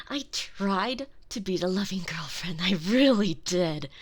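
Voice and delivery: ordinary delivery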